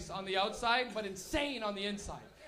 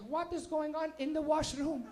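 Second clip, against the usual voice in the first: immigrant mom voice; 4 semitones higher, much slower than usual. Retold or imitated. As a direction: play his Portuguese mom outside the door. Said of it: "My little Portuguese mom".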